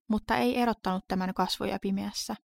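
usual speaking voice

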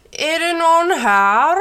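put-on high, loud, strained old gnome voice; a very old man calling out into the room; old gnome asking. A narrator's reading. Old grandfather gnome asks who is there, high and loud. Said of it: "frågade gammeltomten".

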